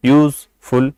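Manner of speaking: Useful broken in two, yooz, pause, ful; useful in two separate beats; split into syllables. Shown as a demonstration. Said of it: "इसमें भी दो syllable है".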